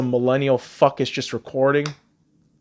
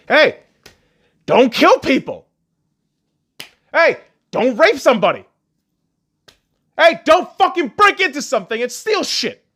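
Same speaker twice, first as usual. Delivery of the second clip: higher, louder sarcastic lecturing; mock scolding; 9 semitones higher, 5 dB louder than usual. Vlogger scolding criminals as if they were children, in mock scolding. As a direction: spell out the obvious rules like to a child.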